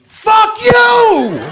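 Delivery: high, loud shouted curse; scream. Retold or imitated. Yelling at the deer eating the hosta, loud and high. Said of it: "I screamed".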